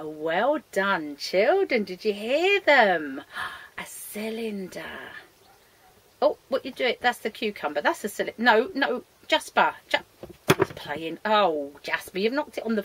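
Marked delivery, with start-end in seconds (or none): none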